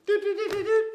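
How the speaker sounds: high little phone beeps; imitated dialling beeps. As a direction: mimic the keypad tones by mouth.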